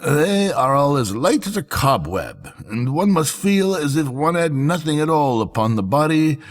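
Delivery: smooth swindlers. A narrator's reading. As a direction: sell the invisible cloth. Swindlers praising the cloth.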